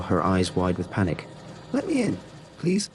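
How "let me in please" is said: high, panicked pleading shout; terrified scream. A narrator's reading. Woman pounding on the locked door begging the clerk, terrified high scream. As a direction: beg through the glass in pure terror. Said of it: "she screamed".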